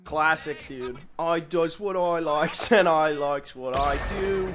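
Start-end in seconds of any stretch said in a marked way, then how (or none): 1.2-4.5 slow, hammed-up cockney; Bert. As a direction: draw it out in a bad cockney accent. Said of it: "horrible cockney accent when I played Bert".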